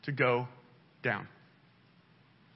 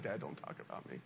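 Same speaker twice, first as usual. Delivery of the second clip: very low, hushed imitation; daughter's aside; 12 semitones lower, 18 dB quieter, faster than usual. Preacher quoting his embarrassed daughter, hushed imitation. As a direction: imitate a small murmured protest under the breath.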